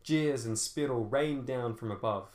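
usual speaking voice

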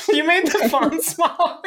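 high, words broken by laughter; cracking up. Real, not acted. Podcaster to co-host about the tiny font, cracking up. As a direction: accuse through helpless giggles.

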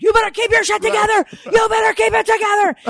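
very high, strained shout; yelling out. Retold or imitated. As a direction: holler a warning, re-enacting it at full pitch. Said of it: "yelling out".

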